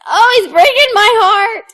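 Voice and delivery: high, loud hammed-up crying; mock sobbing wail. Joking about nightly heartbreak, a loud mock sob.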